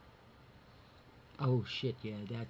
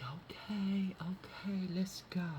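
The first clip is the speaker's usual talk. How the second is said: whisper; 6 semitones higher, slower than usual. Retold or imitated. Hushed urging of cats.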